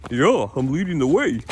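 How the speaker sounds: lightly voiced goofy dog. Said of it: "That's his, uh, dog voice".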